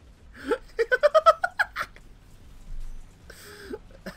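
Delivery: big high burst of laughter; cracking up out loud. Real, not acted. Bursts out laughing at own joke.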